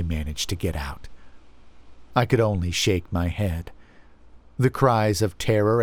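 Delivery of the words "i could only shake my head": usual speaking voice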